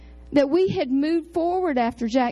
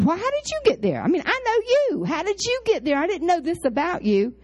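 eager nosiness; 6 semitones higher than usual. Retold or imitated. Eager follow-up questions to trustee.

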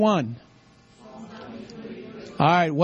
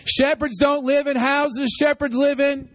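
higher, stressing live; emphatic repetition; 7 semitones higher than usual. Shown as a demonstration. Teacher repeating the line so the church learns it, stressing live. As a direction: drive it home like a teacher. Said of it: "I'm a teacher. Repetition".

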